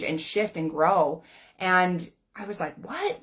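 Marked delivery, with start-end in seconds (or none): 2.8-3.2 shocked high what; a squeaked stunned what; disbelieving what; surprised what. Retold or imitated. Disbelief at husband's change.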